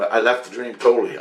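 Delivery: normal talking voice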